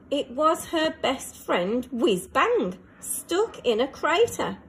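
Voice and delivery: usual speaking voice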